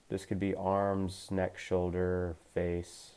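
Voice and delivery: gentle meditation coach voice; guided meditation. Acted. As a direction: ease them into calm awareness, unhurried. Leads a guided body scan.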